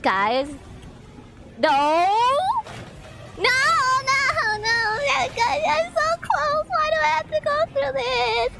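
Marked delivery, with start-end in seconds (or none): 1.6-8.5 high, frantic pleading; desperate as the chase starts; panicking gamer. Real, not acted.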